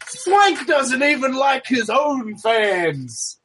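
slow, high mocking taunt; taunting heel. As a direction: sneer it out slowly to the crowd. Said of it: "I'm like".

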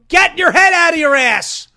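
full shout. Acted. Host translating the British line into a bellowing shout. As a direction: blast it out like a drill sergeant. Blunt version after British take.

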